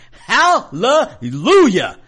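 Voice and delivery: drawn-out, loud, high hallelujah; joyful exclamation. Real, not acted. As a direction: belt it out like church praise. Celebrating libertarian cabinet.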